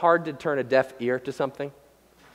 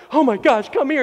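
high, urgent, louder fussing; acted parental alarm; 8 semitones higher, 5 dB louder than usual. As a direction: play a parent rushing to comfort their child. Acts parent rushing to own kid.

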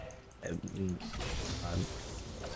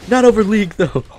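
mock yell; 10 semitones higher, 6 dB louder than usual. Replaying his rant reply.

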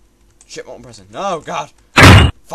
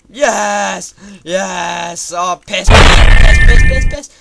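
loud, high cries of joy then dismay; loud elated yell turning into a frustrated one; excited yelling; 5 semitones higher, 14 dB louder, much slower than usual. Real, not acted. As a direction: shout as it goes wrong. Win turns to fail.

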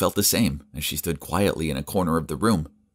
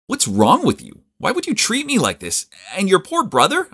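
much higher, loud and outraged; confronting; 13 semitones higher, 4 dB louder than usual. A narrator's reading. Charles confronting Elliot, higher and louder. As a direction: demand answers, voice climbing. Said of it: "I confronted her on the spot".

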